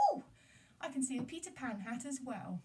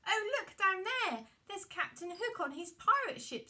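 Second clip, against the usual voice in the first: excited spotting; 7 semitones higher, 6 dB louder than usual. Acted. Spotting Hook from the sky.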